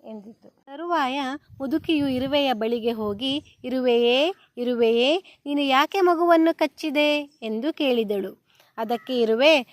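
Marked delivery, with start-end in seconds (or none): 3.6-7.2 calling out loudly. Acted. Grandmother calls out to the ant.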